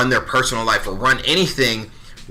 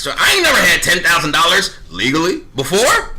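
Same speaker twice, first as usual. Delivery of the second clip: ordinary delivery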